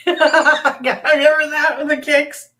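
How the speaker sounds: laughing loudly through the words. Real, not acted. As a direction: crack up while talking. Laughing at Elaine dance memory.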